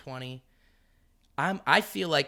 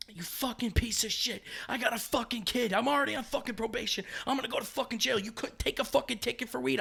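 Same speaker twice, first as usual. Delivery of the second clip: retold angry rant; 10 semitones higher than usual. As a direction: chew the snitch out hard. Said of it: "I'm blowing him up".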